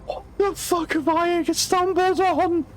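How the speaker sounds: real disbelief, dragged out high; stunned disbelief. Real, not acted. Streamer meeting an octopus-headed clown, stunned and high.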